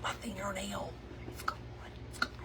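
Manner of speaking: hushed whisper to a friend. Retold or imitated. Whispering to friend Michelle for help. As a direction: whisper it so nobody hears. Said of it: "I quietly tell her".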